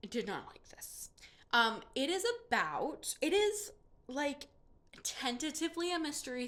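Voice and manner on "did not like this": breathy whispered admission, almost inaudible; whispered confession. Real, not acted. Admitting she disliked the book.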